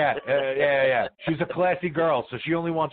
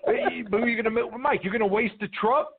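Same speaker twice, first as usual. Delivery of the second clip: yelling; 6 semitones higher than usual. Real, not acted. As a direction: shout it at him. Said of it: "Why are you yelling?".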